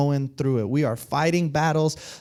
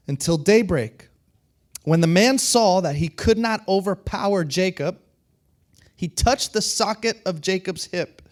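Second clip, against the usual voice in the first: measured, emphatic Bible reading with pauses; scripture reading; 3 semitones higher than usual. A narrator's reading. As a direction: read the passage out to a congregation, phrase by phrase. Reads scripture aloud to congregation.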